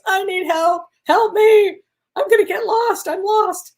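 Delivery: high, loud, frantic cry for help; screaming plea. Retold or imitated. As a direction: scream it to the heavens like you're hopelessly lost. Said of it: "I was screaming at the angels".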